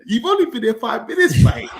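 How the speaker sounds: lightly mimicked catchphrase, high and loud; John Obi Mikel. Host capping a rant about a downloaded accent, mock-indignant impression. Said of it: "in the words of our great prophet and father, John Obi Mikel".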